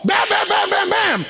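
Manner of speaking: high, punched-out shouted bams; imitated frantic pounding; banging imitation. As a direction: hammer each word like a fist on a door. Acts out pounding on the door.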